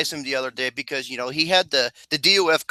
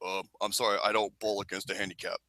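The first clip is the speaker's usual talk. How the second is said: low, soft, quick brush-off; lowered wrestler voice; John Cena impression; 7 semitones lower, 12 dB quieter, faster than usual. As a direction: decline in a deep, hushed voice. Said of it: "impression of John Cena meeting Mister Casual".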